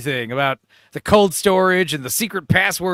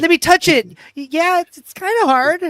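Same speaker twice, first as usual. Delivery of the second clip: high, loud imitation of a lover; hammed-up high female lover; mock eager woman; 12 semitones higher, 6 dB louder than usual. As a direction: put on a comically high girlfriend voice. Woman's part in sex scene.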